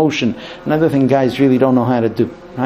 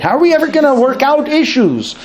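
higher, exasperated cry of a husband; frustrated husband; 11 semitones higher than usual. Retold or imitated. Husband despairing over the argument, exasperated. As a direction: throw up your hands in despair. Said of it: "the man gets really frustrated".

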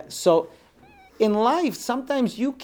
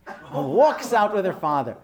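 ordinary talk, nothing marked

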